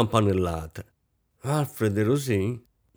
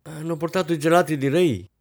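high, raised voice summoning someone; calling out; 7 semitones higher, 3 dB louder than usual. A narrator's reading. Hostess calling the servant, impatient. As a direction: call out, unable to wait any longer. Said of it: "non resistette più, chiamò".

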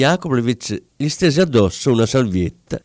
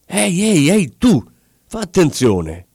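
alarmed warning cry; 6 semitones higher than usual. A narrator's reading. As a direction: call out sharply to stop someone. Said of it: "gridò Kovalyov". (grido di avvertimento)

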